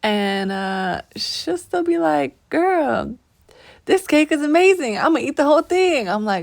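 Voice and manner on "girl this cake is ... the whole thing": higher, gushing praise; excited, over-the-top compliment; gushing friend. Retold or imitated. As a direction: rave about a cheap cake.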